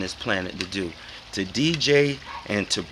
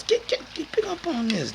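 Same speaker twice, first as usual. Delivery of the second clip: very high scratched sample mimic. Mark scratching the record, high mimic. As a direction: cut it like a turntable scratch. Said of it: "Mark starts".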